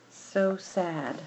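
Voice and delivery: soft, fading sorrowful voice; sad. Acted. Patches pitying Fenway, soft and sad. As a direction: sigh it out gently. Friend consoling dog softly.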